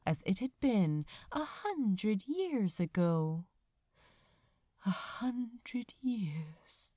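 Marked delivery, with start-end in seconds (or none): 4.9-6.9 slow, hushed whisper, softer; murmured. Acted. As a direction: barely breathe it out, lost in memory. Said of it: "he murmured aloud".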